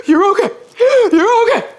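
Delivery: high, loud panicked reassurance with gasps; gasping, acted panic; freaking-out mother. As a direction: imitate a mom frantically reassuring, loud and high. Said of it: "freaking out".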